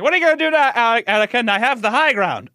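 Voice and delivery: hammed-up gloating at Anakin; Obi-Wan taunt. Obi-Wan taunting Anakin from the high ground, hammed-up smug. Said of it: "he's like".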